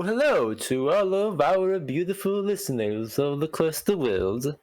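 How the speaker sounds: hammed-up fake French accent; a mock-French host greeting the listeners. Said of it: "this is my French accent".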